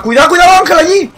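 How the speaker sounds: high, loud urgent warning; alarmed warning shout. Real, not acted. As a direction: yell the danger out. Warning teammate of enemy.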